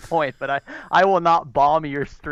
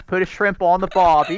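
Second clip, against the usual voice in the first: attempted Aussie accent, drifting toward English; 4 semitones higher, 4 dB louder than usual. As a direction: read it in a tentative put-on Australian accent. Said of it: "he can kinda do an Aussie accent".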